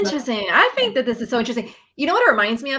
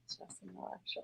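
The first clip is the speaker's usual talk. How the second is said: whispering; 4 semitones lower, 23 dB quieter than usual. Shown as a demonstration. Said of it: "repeating the tapping phrase".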